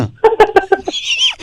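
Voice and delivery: giggling high and breathy; stifle a laugh, fail; high giggle. Acted. Prankster giggles while lecturing the caller.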